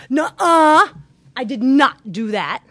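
loud, high, indignant protest; defensive denial. Retold or imitated. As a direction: shout it down, getting defensive. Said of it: "this is instead of".

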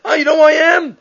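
high, loud outraged outburst, acted out; indignant screaming. Honored congregant screaming at the gabbai over his aliyah, mock outrage. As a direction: act out an offended man yelling, pitch way up. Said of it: "starts screaming at the gabbai".

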